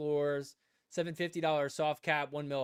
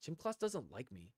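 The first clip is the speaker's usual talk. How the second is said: bummed mutter; 9 dB quieter than usual. Retold or imitated. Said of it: "I was like".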